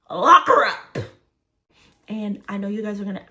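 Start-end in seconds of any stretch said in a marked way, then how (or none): 0.1-1.0 high, full-volume yell; outraged shout. Acted. Storyteller on the woman plotting against her, outraged shout. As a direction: shout it out like a chant leader. Mock outburst at the mother's plot.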